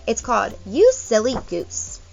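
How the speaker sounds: ordinary talk, nothing marked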